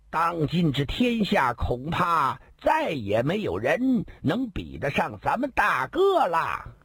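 high, slow grand bragging; slow boastful bragging. Acted. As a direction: draw out each boast proudly. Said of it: "眉峰往上一挑".

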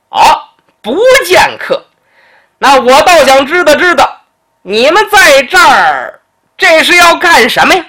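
haughty nobleman. Acted. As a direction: press the petty official with lofty scorn. Noble challenges arrogant secretary. (高声傲慢的质问)

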